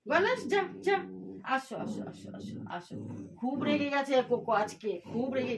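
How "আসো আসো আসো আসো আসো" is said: softer, half-whispered calling; soft coaxing. Real, not acted. Owner softly coaxing her angry pet to come. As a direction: hush it to lure the pet in.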